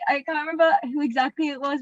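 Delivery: ordinary delivery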